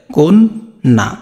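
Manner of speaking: কোন না slowly split, "kon... na"; syllable by syllable. Shown as a demonstration. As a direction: hold কোন, then a separate না. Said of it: "এটা তুমি উচ্চারণ করো". (ধীরে ভেঙে কোন... না)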